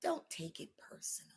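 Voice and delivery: whispered advice; hushed, intimate self-talk. Real, not acted. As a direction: breathe it out as a private reminder. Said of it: "this is what I did".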